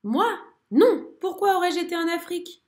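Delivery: non lancé fort et aigu, surpris; étonné, presque indigné; merle surpris. A narrator's reading. (merle étonné, voix haute)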